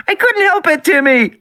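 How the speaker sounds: put-on high, loud and desperate child's shriek; miserable shriek. A narrator's reading. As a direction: wail it like a frightened little girl. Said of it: "Lex shrieked miserably".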